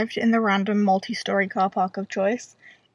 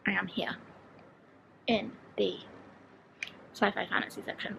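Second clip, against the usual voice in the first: soft hushed aside; whispering; 7 dB quieter than usual. Real, not acted. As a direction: keep the voice down in the shop. Whispering on in bookshop.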